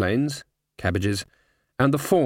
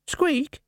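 squeak. Acted. Rat answers.

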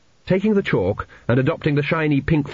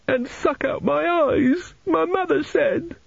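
slow, very high tearful wail; sobbing boy; slower than usual. A narrator's reading. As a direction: draw it out like a frightened little boy. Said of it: "sitting on the edge of his bed and sobbing".

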